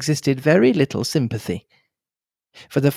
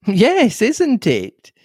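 put-on higher, lively young woman's voice; a chatty, bubbly young nurse; young girl Cynthia; 9 semitones higher, much slower than usual. A young nurse gossips to Hastings, lively and higher.

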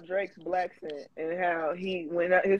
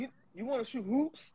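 high, softer mock Canadian dad; 4 semitones higher, 8 dB quieter than usual. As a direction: play a Canadian dad, pitched up.

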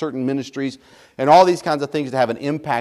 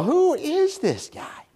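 high, slow and softer mocking voice; scoffing disbelief; 12 semitones higher, 4 dB quieter, slower than usual. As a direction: sneer at someone who talks big. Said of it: "you go".

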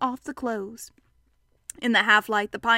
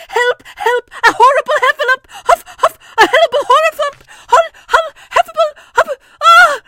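very high, loud, fast squealing; crying out in panic; 14 dB louder than usual. Acted. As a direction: tumble over the words, puffing in terror. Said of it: "still crying out".

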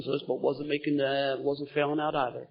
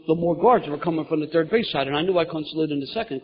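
normal talking voice

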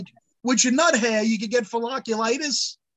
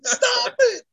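much higher, louder, exasperated shout; retold angry yell; 10 semitones higher, 4 dB louder, slower than usual. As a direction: demand they stop typing. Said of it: "I'm like".

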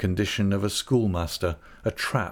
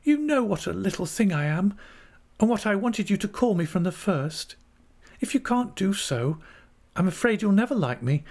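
put-on high, childlike young wife, hesitantly pleading; Dora; 10 semitones higher than usual. A narrator's reading. Narrator plays Dora pleading.

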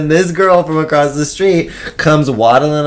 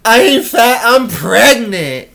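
indignant pregnant woman; 10 semitones higher, slower than usual. Retold or imitated. Stranger revealing she is pregnant, mock outraged. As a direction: deliver the punchline with full sass. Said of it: "just goes".

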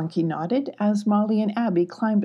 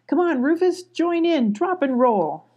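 cheerful coaxing; 8 semitones higher, 4 dB louder than usual. Acted. Coaxing the kitten to join.

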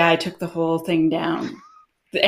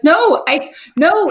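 panicked protest; 10 semitones higher, 5 dB louder, slower than usual. Retold or imitated. Refusing mentor in retold scene.

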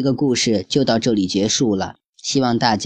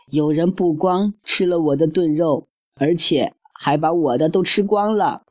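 higher small-cub voice, mock tearful; crying baby bear; 6 semitones higher than usual. Baby bear to family, higher mock-sobbing voice. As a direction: whine like a little bear who lost his stew. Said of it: "他哭得更伤心了".

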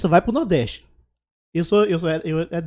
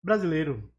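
mouth full; 2 semitones lower, 6 dB quieter than usual. Real, not acted. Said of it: "Desculpa, gente, tô comendo".

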